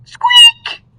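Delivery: sharp high squeak; loud squeak. Acted. The noise from the roof, sharp high squeak.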